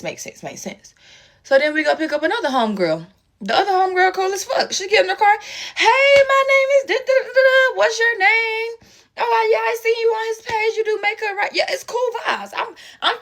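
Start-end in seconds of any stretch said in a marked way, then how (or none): 0.0-0.9 breathy under-the-breath murmur, faking agreement; whispered sarcastic agreement. Retold or imitated. To herself about the boyfriend's car, a sarcastic whisper. Pretending to agree, inwardly annoyed. 5.8-11.5 put-on much higher, bright and louder, a chatty girl introducing herself; bubbly friendly homegirl. New homegirl greeting her in the car, bubbly and high.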